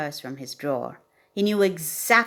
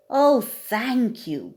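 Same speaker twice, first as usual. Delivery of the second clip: slow, higher, louder grateful gasp; gasped thanks; 6 semitones higher, 5 dB louder, much slower than usual. A narrator's reading. Bobcat thanks the old badger, gasping with delight. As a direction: draw the thanks out in happy surprise. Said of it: "gasped Bobber Cat".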